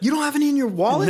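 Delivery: high fast disbelief; quick, high, can't believe it; surprised. Real, not acted. Surprised at empty wallet.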